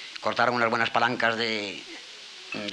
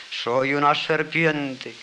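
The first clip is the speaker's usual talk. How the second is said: serpent voice; 4 semitones higher, 4 dB louder, much slower than usual. Acted. Serpient answers the fox.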